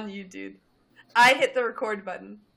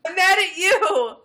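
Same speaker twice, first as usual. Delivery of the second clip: raised, louder playful retort; mock anger; 8 semitones higher, 5 dB louder than usual. Host trading accusations with co-host, mock angry. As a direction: fire it back in pretend outrage.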